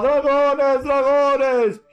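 grito alto y agudo de pánico; gente gritando asustada. Acted. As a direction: chilla como la gente aterrada. (la gente grita asustada: dragones)

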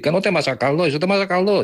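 high mocking busybody voice; nosy friend. A beer-holding friend nags the paella cook in a high mocking voice. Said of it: "pasa por ahí y dice".

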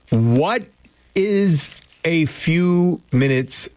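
"what... is... a few minutes late", word by word; slow and deliberate. Real, not acted. Question repeated slowly for listeners.

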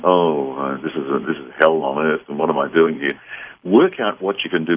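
normal talking voice

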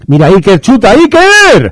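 high, rising football commentator's cry; excited shot call. Real, not acted. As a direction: pitch leaping up into a cry. Commentator calls a shot on goal.

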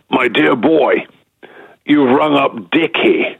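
slow, grand actorly voice; lightly voiced grand old actor, drawn out; booming voice. News agency reporter's caller answered by a lightly voiced grand actor. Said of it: "This booming voice comes back".

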